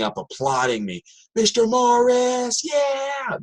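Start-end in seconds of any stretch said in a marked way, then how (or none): 1.3-3.4 high, drawn-out cheering yell; cheering scream. Retold or imitated. Students on their feet cheering for their teacher, high drawn-out yell. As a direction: scream his name like a fan at a concert. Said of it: "Just screaming".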